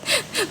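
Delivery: short high giggle; laugh. Real, not acted. Streamer teases a viewer, then a high giggle. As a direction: giggle at your own tease.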